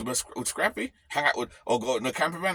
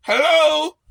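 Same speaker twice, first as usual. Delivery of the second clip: high, loud sarcastic hello; mocking hello; 10 semitones higher, 9 dB louder than usual. Mocking the complainers with a loud sarcastic hello. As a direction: call out as if knocking on an empty head.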